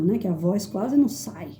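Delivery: ordinary talk, nothing marked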